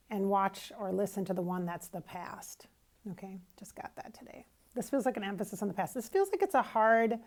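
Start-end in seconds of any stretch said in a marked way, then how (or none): 3.1-4.5 quick unvoiced aside whispered; whispered aside to the viewers. Real, not acted. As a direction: drop to a whisper as if sharing a secret. Aside while plugging the podcast.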